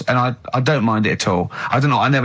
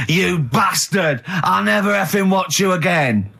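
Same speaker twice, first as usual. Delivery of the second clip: slow, raised-pitch mock shout; retold angry outburst; 5 semitones higher, slower than usual. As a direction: imitate an outraged drunk fan, dragging out the insult. Drunk woman's angry outburst, retold.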